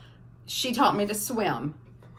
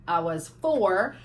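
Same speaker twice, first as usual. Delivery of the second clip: normal talking voice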